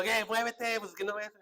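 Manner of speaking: high-pitched impatient driver's holler; mock road-rage shout; retold yelling. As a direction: re-enact yelling at someone to move out of the way.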